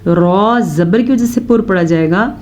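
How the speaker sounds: ordinary talk, nothing marked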